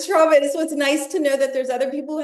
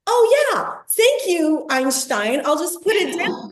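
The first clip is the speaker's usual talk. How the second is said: higher, loud mock gratitude on oh yeah; sarcastic thanks; 4 semitones higher, 3 dB louder, slower than usual. Mocking the book's advice to quit smoking, sarcastic. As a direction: roll your eyes at useless advice.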